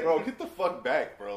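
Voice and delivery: loud, high, drawn-out mock order; retold backing-off warning. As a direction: act out shoving someone away. Acting Brian shoving man off.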